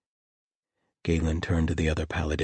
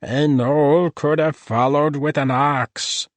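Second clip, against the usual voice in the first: high, slow, offended gnoll voice; indignant gnoll; 9 semitones higher, 8 dB louder, slower than usual. A narrator's reading. Gnoll complaining about being put on a horse, indignant. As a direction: huff it out like an insulted gnoll. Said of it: "said an extremely indignant voice".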